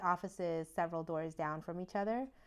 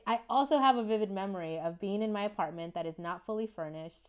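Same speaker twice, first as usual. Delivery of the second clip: ordinary delivery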